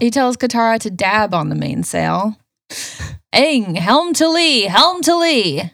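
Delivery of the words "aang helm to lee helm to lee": high, slow captain's call; shouted command. Retold or imitated. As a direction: call it out across the deck. Sokka calling orders on boat.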